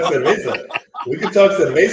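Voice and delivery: put-on laughing, Chicago-accented cerveza. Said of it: "worst Chicago accent I've ever heard".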